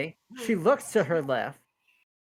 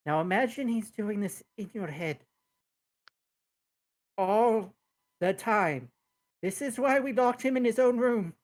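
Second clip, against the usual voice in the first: higher-pitched in-character complaint; acted exasperation; 6 semitones higher than usual. Twin explains why they locked him away, mildly exasperated. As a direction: complain in character, stressing each part.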